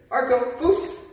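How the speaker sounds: dog command. Real, not acted.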